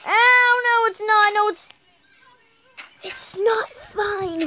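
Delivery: high, loud sudden alarm; shocked squeal, then a slow, drawn-out "not fine"; panicked yelp. Real, not acted. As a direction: cry out as it all goes wrong. Realizes the slimes broke through.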